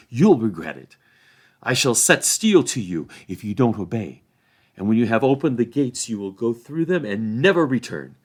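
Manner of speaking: loud, commanding ultimatum; Merry threatening Bill Ferny with steel; stern threat. A narrator's reading. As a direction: order the ruffian out with cold authority.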